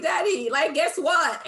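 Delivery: put-on high, loud, playful quote of an eager kid; excited child to dad. Speaker challenging dad with new words, excited. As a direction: call out to Daddy, bursting with news. Acts out teasing her dad.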